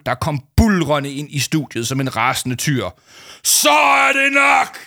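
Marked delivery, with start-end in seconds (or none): 3.4-4.8 huge, slow bellow; roaring. A narrator's reading. Giant teacher bursting into the studio, roaring. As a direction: shake the stage with it. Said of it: "brølede Paul med en stemme så kraftig".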